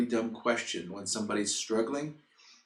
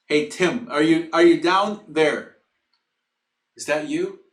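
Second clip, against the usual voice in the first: loud, raised-pitch call to a friend below; yelling down; 6 semitones higher, 11 dB louder than usual. Retold or imitated. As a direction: holler down, checking who is there. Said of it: "there's a voice up above yelling".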